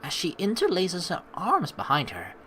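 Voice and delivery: ordinary talk, nothing marked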